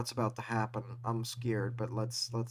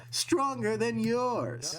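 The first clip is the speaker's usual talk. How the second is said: villain voice; 9 semitones higher, slower than usual. Acted. Voicing a villain, high and slow.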